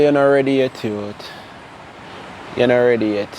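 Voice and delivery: a hint of a mentor cutting him down; lightly voiced stern warning. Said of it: "Man say".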